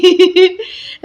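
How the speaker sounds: high amused laugh, real. Real, not acted. Vlogger recalls the little girl staring at the sky, amused laugh. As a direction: burst into a high giggle.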